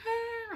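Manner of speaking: high, held mock wail; one high note imitating a child's bawl; imitated crying wail. Daughter calling Mommy in tears, imitated wail. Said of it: "She's calling me crying".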